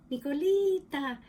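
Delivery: high, loud call of a pet name; grandmother's intonation. Retold or imitated. Grandma's voice popping in while cooking, high loud call. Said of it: "that intonation is connected to my grandmother".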